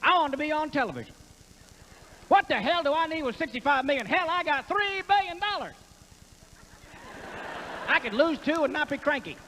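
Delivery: Ross Perot. Acted. Comic playing Ross Perot explaining his campaign, high twang. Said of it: "Ross Perot".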